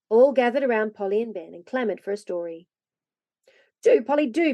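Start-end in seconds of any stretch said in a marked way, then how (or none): 3.8-4.5 put-on higher, loud child's plea; begging. A narrator's reading. Joel begs Polly for a story, pleading. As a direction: plead eagerly for a treat. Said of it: "begged Joel".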